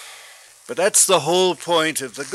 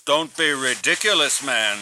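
usual speaking voice

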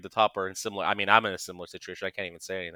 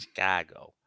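mock Chicago accent; 2 semitones lower, slower than usual. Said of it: "New York is Chicago".

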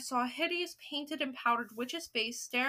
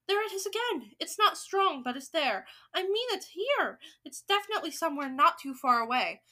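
high, excited insistence; shout; 4 semitones higher, 5 dB louder than usual. Acted. First witch tracking the smell for others, shouting excitedly. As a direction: press the point in a raised voice. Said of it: "shouted the first witch".

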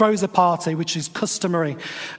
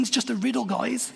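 lightly exasperated, a touch higher and softer; mock disbelief, just a riddle, guys; exasperated aside; 4 semitones higher, 5 dB quieter than usual. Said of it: "I'm thinking".

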